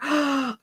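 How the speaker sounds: a sharp high gasp of delight. Real, not acted. A fan hearing her favourite episode named, delighted gasp. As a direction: gasp with excitement.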